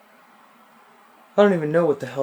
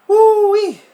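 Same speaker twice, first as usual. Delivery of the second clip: loud, much higher whoop; 11 dB louder than usual. Real, not acted. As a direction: yelp at the burning drink. Reaction to drinking strong wine.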